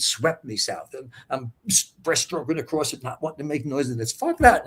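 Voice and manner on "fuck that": exasperated outburst. Retold or imitated. Inner outburst in the river.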